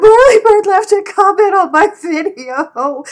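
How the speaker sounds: very loud, high tearful wail; hammed-up bawling. Re-enacting fangirl news to cousin on FaceTime, hammed-up bawling. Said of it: "I'm bawling my eyeballs out".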